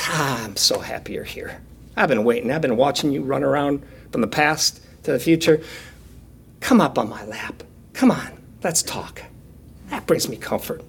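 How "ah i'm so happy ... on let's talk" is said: softer and lower, tender welcome that sinks to a whisper; Jesus soothing a worn-out child, soft and tender; gentle Jesus voice. Acted. Jesus welcoming exhausted little Stevie onto his lap, softly tender.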